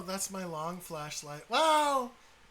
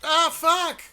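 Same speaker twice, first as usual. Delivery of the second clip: startled shout; 7 semitones higher, 11 dB louder than usual. Real, not acted. Sudden scare while playing.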